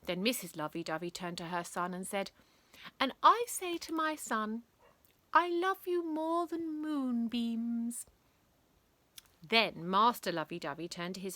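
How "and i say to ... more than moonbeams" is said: higher, slow, syrupy mother's voice; a high, slow lovesick mum; soppy mother. Acted. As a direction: drip love over every word to your son. Mother declares love to her son.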